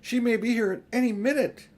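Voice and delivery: put-on high, loud, urgent girl's voice; worried girl urging haste, pitched high; anxious Susan. A narrator's reading. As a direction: press her to hurry.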